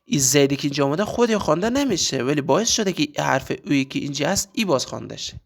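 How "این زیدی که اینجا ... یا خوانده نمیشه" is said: ordinary talk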